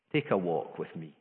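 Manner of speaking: gentle invitation. Retold or imitated. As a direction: invite them tenderly. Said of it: "he dealt with them sensitively".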